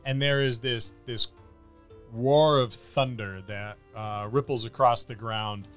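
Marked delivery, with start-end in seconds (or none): none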